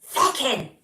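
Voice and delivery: loud mock yell across a field; yelling a dog's name. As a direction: call the dog from the far end of the field. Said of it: "just be yelling".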